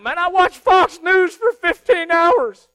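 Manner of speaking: high, loud, slow hammed-up fretting; mock a cable-news worrier; over-the-top anxious voter; mock panicked viewer. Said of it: "Everybody's freaking out".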